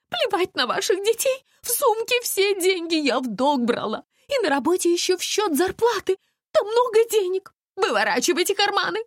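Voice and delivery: high hysterical ranting; yelling. A narrator's reading. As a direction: yell demands in a frantic high voice. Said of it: "орала Настя".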